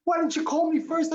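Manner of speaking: high, indignant wife's complaint, imitated; a retold wife's outraged question; mock angry wife. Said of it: "She was so mad".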